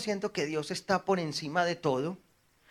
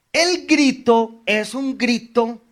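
slow loud proclamation; 6 semitones higher, 5 dB louder, slower than usual. Real, not acted. Preacher's emphatic declaration.

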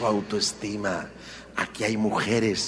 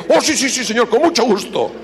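fake eager yes; 10 semitones higher, 6 dB louder than usual. Worker fakes eager agreement.

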